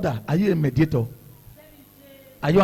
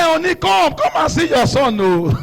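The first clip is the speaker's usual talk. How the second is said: calling out; 8 semitones higher than usual. Retold or imitated. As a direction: call the wife to come at once. Calling wife to another room.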